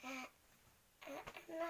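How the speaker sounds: ordinary delivery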